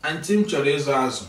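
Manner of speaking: fast, casual market talk; quick market request. Shown as a demonstration. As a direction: toss it off fast to the seller. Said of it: "you will say something like this".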